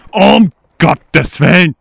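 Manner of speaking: loud, slow, horrified cry; shocked exclamation. Real, not acted. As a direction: blurt it out in shock. Shocked at the overgrown garden.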